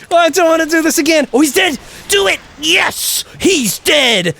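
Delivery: high-pitched dread bursting into triumph; frantic elation. Real, not acted. Player finally killing the boss, frantically relieved. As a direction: cry out high, from panic to victory.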